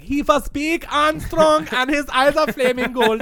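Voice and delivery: loud, high, slow camp German; camp German guy. Pretend. Co-host pitching a Rasputin cover, camp German accent. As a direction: belt it in a flamboyant German accent. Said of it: "like, the gayest German guy you can find".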